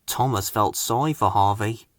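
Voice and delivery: ordinary delivery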